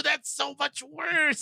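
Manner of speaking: high, drawn-out exaggerated groaning words; mock wince. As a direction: squeal it slowly in pretend sympathy pain. Acted reaction to retold death.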